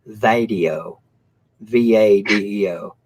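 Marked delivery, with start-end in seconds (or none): 0.1-1.0 "vadio" with a flat a, like vad-ee-oh. Retold or imitated. Imitating Camel Toes saying video as "vadio". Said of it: "it's almost like".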